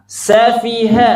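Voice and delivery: "safiha" in three equal beats, high and slow; evenly balanced syllables. Shown as a demonstration. Teacher asks students to watch the balance, "safiha" evenly timed. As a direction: keep every syllable of "safiha" the same length. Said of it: "Perhatikan keseimbangannya".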